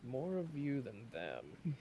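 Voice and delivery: soft and slow, half to himself; muttered to himself. A narrator's reading. Sailor Jack muses to himself, soft and slow. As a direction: turn the words over quietly, slowly. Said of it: "kinda more to himself than to you".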